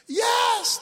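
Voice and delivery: high, urgent cry; screamed reply. Acted. Man on the branch answering God, high urgent cry. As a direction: call up an eager yes. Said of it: "and he screamed".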